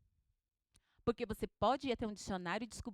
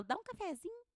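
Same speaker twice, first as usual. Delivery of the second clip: a high, soft Minas-style "Dá um cafezinho", sung up at the end; clipping "cafezinho" the Minas way; Minas accent; 8 semitones higher, 7 dB quieter than usual. Retold or imitated. Teacher imitating how she talks after a week in Minas. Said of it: "Eu fico uma semana em Minas, eu falo".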